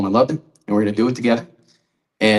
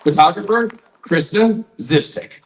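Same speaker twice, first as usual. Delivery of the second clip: emcee announcement; 8 semitones higher than usual. Acted. Emcee presenting honorees to the crowd, announcer voice. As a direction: call each name out to the crowd like an emcee.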